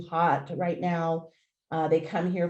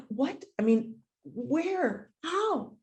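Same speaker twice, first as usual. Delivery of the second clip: higher, bewildered questions; stunned disbelief; 5 semitones higher, much slower than usual. Retold or imitated. Owner amazed at a stranger appearing, high and stunned. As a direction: gasp out each question in confusion. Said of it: "And I'm like".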